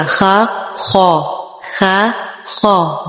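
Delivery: خا with rounded lips, slow and heavy. Shown as a demonstration. Teacher showing the rounded heavy خا. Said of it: "difference ہے نا؟".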